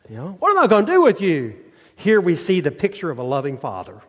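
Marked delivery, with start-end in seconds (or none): 0.1-1.6 mock fed-up parent, higher and quicker on the second; a hint of a fed-up mom; exasperated parent. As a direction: imitate a parent scolding kids. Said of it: "Any of you parents ever did that with your kids?".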